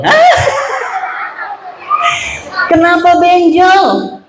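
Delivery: high, shocked mum asking what happened; mother hearing her child got hurt, high shocked question; startled mother. Acted. Mother reacts to bumped child.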